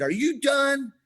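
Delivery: high, loud imitated challenge; retold shout. Retelling a dad and son's plank standoff, shouted. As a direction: throw the question out hard and high.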